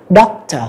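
Doctor split as doc-tor, break between K and T; split syllables. Shown as a demonstration. Teacher modelling the syllable split in Doctor. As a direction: divide Doctor cleanly between K and T. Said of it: "Chúng ta chia ra".

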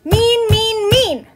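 high, loud rhythmic chant-yell; furious yell. A narrator's reading. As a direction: yell it in time to drumming heels. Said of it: "she yelled".

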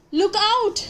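high sudden warning cry; warning shout. Acted. Park keeper warning the flying owl, high shouted cry. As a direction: yell a warning, pitched high. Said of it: "shouted Percy".

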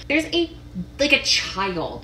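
ordinary talk, nothing marked

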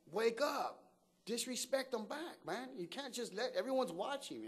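high-pitched urging to himself; urgent inner voice. Retold or imitated. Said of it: "in my head, I'm like".

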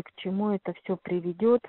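ordinary talk

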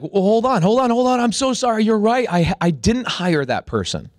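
high, loud frantic apology; begging the coach to keep him on, flustered; panicked backpedaling. Retold or imitated. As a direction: scramble to take it back before he leaves. Begging the coach not to fire.

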